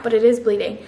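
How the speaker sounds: ordinary talk, nothing marked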